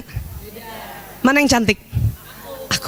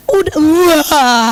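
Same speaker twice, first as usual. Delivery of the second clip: choked up; 4 dB louder, much slower than usual. Real, not acted. Overcome while speaking.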